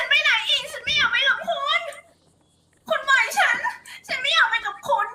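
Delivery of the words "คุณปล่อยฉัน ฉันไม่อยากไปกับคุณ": higher, tearful pleading with laughter slipping in; acted crying. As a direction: beg him to let go, sobbing. (ร้องไห้อ้อนวอนแบบแสดง)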